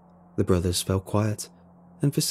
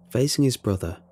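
usual speaking voice

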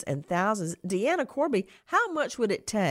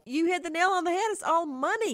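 excited; 11 semitones higher, faster than usual. Real, not acted. Excited agreement with guest.

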